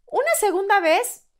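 son's answer, imitated. Son weighs a second trip for his mother, imitated solemn child. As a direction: voice a little boy pronouncing his verdict. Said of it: "Me dice".

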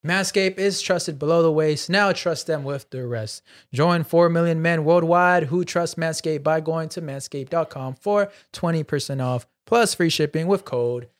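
measured, announcer-like sponsor read. Acted. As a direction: deliver it like a podcast sponsor shout-out. Reads sponsor ad.

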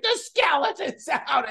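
very high, put-on child's squeal; mock scream of delight at normal volume; imitated fired-up kid. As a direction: imitate a thrilled nine-year-old. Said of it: "and she's so fired up".

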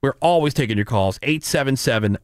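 ordinary talk

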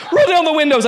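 screamed high and loud, with a laugh in it; panicked scream. Retold or imitated. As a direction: yell it in panic, laughing through it. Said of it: "I screamed".

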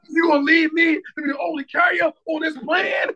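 high, wailing indignant protest; mock outrage. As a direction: wail it like a betrayed dad, hammed up. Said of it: "it was anger, like".

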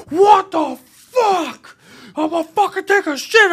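high, loud furious yell through the door; angry yell. Retold or imitated. Man in bathroom yells at door-kicker, furious shout. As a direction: blast it like he's been interrupted on the toilet.

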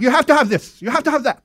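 high, loud mock German accent; bossy official in a German accent. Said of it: "thinking of Rick speaking in the German, uh, accent".

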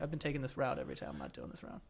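fast, then fading mutter; quiet muttering to self. Retold or imitated. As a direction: mutter it under your breath. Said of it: "I'm like".

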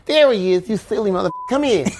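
high, lisping boxer voice; excited champion impersonation; Mike Tyson. Retold or imitated. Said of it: "Mike Tyson walks up to me and says".

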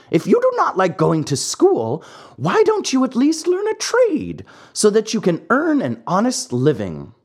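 put-on an old, patient cricket introducing himself; wise talking cricket. Cricket's held voice.

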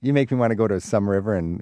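normal talking voice

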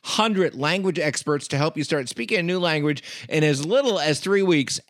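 lively podcast commercial delivery; ad read. Real, not acted. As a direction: sell the product, punching the key words. Reads sponsor ad.

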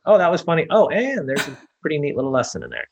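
high-pitched acted delight, like a viewer catching on; mock reaction pitched well up; amused discovery. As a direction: play a pleasantly surprised viewer. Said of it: "versus just laughing, going".

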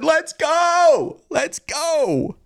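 high, rallying fan cry; real high-pitched victory cheer; celebratory hype cry. Real, not acted. Celebrating win, called loud [name].

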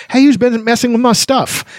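high, put-out kender protest; a kender catching someone in his pouches; indignant kender. Acted. Kender to the party about his pouches, high indignant.

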